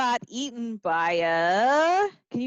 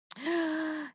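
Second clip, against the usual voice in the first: mock gasp; 3 semitones higher, 7 dB quieter than usual. Gasp at fat tummy.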